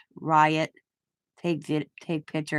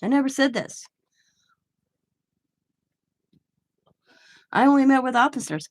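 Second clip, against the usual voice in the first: higher, fast protest imitating a witness; mock indignant denial, higher and quick; 5 semitones higher, faster than usual. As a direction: rattle it off high like the indignant witness. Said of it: "And she goes".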